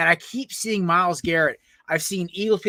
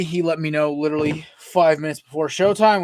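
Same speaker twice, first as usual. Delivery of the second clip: ordinary talk, nothing marked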